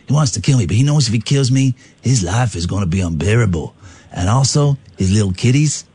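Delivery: mock-menacing American mobster; gangster. Henchman threatening the sergeant's little kiddies, mock menace. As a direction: threaten like a hammy movie gangster.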